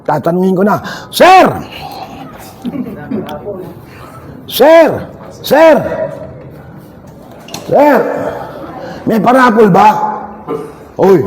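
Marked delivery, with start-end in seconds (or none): 1.1-8.0 raised, repeated hail; calling out. Real, not acted. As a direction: call for someone's attention. Calling to someone for attention.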